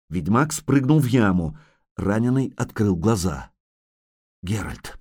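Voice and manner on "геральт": weak, very soft moan of a name. Acted. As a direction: moan it out, barely audible. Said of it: "простонал он". (слабый стон)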